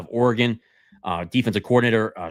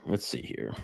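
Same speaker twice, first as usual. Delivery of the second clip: quiet mutter; 8 semitones lower, 10 dB quieter than usual. Real, not acted. Searching his list, muttering aside.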